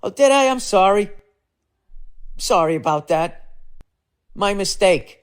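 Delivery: very high sarcastic sing-song apology; fake-grovel with sugary sarcasm; mock apologetic. Mocking the hat store clerk with high sarcastic apology. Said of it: "I should have just been like".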